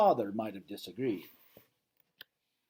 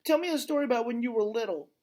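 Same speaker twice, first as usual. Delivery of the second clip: put-on high, fast eager child's plea; pleading child; 10 semitones higher, faster than usual. A narrator's reading. Young elephant begs Ivan for a story, high pleading voice. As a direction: beg like a little elephant who can't sleep. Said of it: "Ruby pleads".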